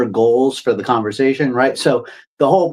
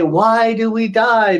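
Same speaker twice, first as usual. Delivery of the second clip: put-on little kid asking why; 9 semitones higher, slower than usual. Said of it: "like you're a little kid".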